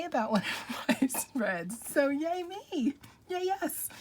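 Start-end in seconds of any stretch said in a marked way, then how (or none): 1.9-3.9 self-cheer. Real, not acted. Cheering for herself.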